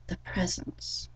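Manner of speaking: ordinary talk, nothing marked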